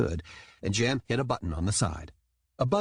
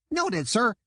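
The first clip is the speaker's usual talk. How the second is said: squeaky dolphin soldier; 12 semitones higher than usual. A narrator's reading. Jam dolphin voice.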